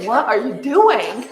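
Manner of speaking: slow, comic disbelief; mock bewilderment. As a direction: imitate baffled kids, half laughing. Imitates her puzzled boys' reaction.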